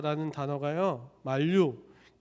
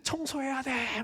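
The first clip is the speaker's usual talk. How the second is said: excited daughter; 8 semitones higher, 4 dB quieter, slower than usual. Acted. Excited daughter rushing home.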